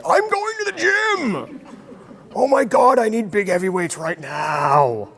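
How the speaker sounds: sudden high, slow, hyped-up cry with a laugh; fired up like the music just hit; pumped up. Retold or imitated. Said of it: "and you're like".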